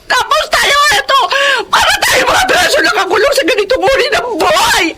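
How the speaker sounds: sumisigaw habang umiiyak; umiiyak na sigaw. Acted. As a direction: ibuhos ang galit at pagod nang pasigaw. Galit at iyak sa pagtatalo. (asawang babae, umiiyak at sumisigaw sa asawa)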